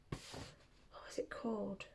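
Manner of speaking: whispered to herself; whispering. Real, not acted. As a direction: whisper it, thinking aloud. Searching her notes aloud.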